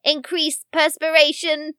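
high, deliberate robotic announcement, item by item; computer readout. Retold or imitated. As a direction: read it out like a ship's computer. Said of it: "as the computer reads out".

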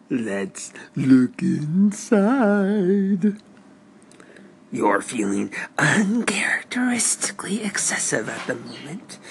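slow, breathy whispered reading; horoscope read. Acted. Reads a horoscope.

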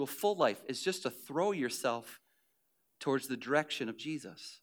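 tender appeal. Acted. Pastor inviting the hurting to let go, soft and tender. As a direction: draw them in gently, almost confiding.

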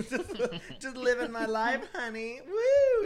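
high, loud tipsy whoop; carefree party cheer. Retold or imitated. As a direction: sing it out, merry and careless. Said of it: "And I was just like".

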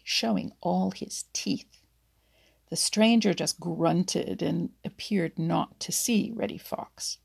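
usual speaking voice

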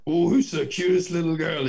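high, sing-song coo to a pet; high cooing baby talk to a dog. Shown as a demonstration. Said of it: "My voice goes".

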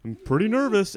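high, loud flustered stammer; nervous excuse. Retold or imitated. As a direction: fumble an excuse, rattled. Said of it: "he's like".

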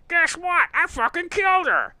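high, loud cartoon character. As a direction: ham up a cartoon confession. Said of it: "they're like".

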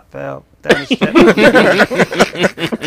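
very slow, stretched-out coached answer; drawn-out lie. Retold or imitated. Kid reciting the lie to the social worker, very slow. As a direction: drag each word out like a rehearsed lie. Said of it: "I'm like".

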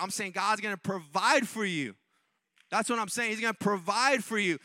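emphatic sermon declarations with stretched key words; forceful preaching. Real, not acted. Preacher promising the congregation God will provide, forceful preaching. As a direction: insist on it to the whole congregation.

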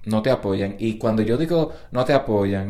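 ordinary talk, nothing marked

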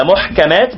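محكمات as muḥkamāt, single k; lightened kaf. Shown as a demonstration. Teacher showing what lightening costs the metre, single kaf. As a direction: drop the doubling in محكمات. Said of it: "فإذا خففتها وجعلتها".

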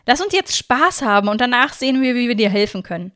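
rally a friend with joy; motivated, beaming. A narrator's reading. Friend urges narrator to have fun now, beaming. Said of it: "völlig motiviert und freudestrahlend".

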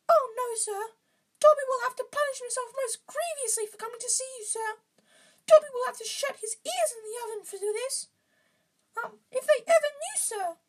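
very high, shuddering elf character; Dobby. A narrator's reading. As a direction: squeak anxiously in falsetto. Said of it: "Dobby shuddered".

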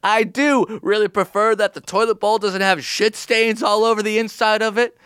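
high barking drill sergeant. Pretend. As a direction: bark orders like a drill instructor. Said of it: "I live with someone named General Cleanliness".

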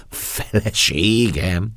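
escalating stunned question, swelling and rising; dazed astonishment. Acted. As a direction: repeat it bigger, stretching the word in amazement. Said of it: "hebegtem káprázó szemmel".

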